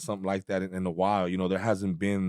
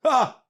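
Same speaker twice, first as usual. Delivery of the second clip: mock startled yelp; 14 semitones higher, 5 dB louder than usual. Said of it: "He's like".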